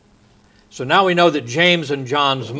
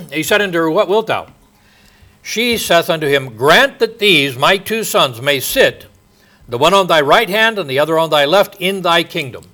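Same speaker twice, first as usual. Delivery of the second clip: raised, louder Bible-reading voice; higher, emphatic scripture recitation; 5 semitones higher, 5 dB louder than usual. Acted. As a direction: proclaim the verse from the pulpit. Reads scripture aloud.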